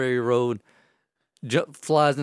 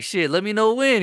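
higher-pitched, game reply; retold cocky acceptance; 9 semitones higher, 4 dB louder than usual. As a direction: take up the dare eagerly. Accepts race challenge.

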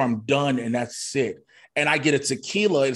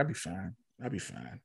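soft, fading "I'll be fine" to himself; quiet self-reassurance; 5 semitones lower, 15 dB quieter than usual. Retold or imitated. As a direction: brush it off under your breath. Dismissing his own depression.

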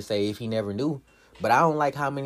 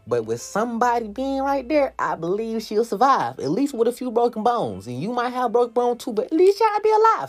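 rising, louder, more and more worked up; 10 semitones higher, 3 dB louder than usual. Real, not acted. Reacting to a movie, arguing someone should catch the falling girl, worked up. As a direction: get fired up insisting he should catch her.

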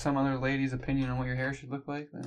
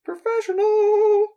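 mock shout; 13 dB louder, slower than usual. Mocking their own professionalism.

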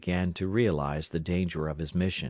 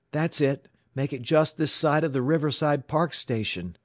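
high, eager confirmation; raised, pressing voice; urgent instruction; 8 semitones higher, 4 dB louder than usual. A narrator's reading. Urgent instruction over the wire.

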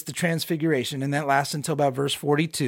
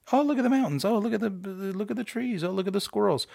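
higher-pitched, lightweight mock wonder; mock sightseer; 5 semitones higher than usual. Preacher mimicking disciples on a road trip, in mock wonder. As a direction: play a road-tripper pointing out every little sight.

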